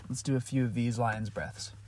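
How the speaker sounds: quiet, calm breathing guide; guided breathing. Acted. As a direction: lead them softly through each breath. Leads breathing exercise.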